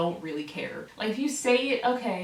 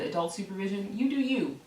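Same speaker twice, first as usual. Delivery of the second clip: usual speaking voice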